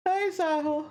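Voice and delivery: ordinary talk, nothing marked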